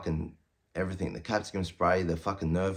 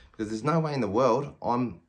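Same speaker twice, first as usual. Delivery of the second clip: normal talking voice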